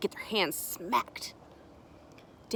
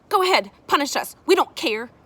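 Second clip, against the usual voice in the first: loud, raised-pitch challenge; defiant; 7 semitones higher, 9 dB louder than usual. A narrator's reading. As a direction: dare them to punish you. Child defiantly dares the adult.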